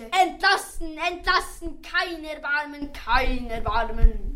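high, sharp and final; a king refusing mercy, mildly sharp. Acted. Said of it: "mit scharfer Stimme wiederholte".

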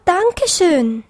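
high bunny voice, falling away; a touch of overwhelmed gratitude; stammered thanks. A narrator's reading. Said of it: "stammelte Tips".

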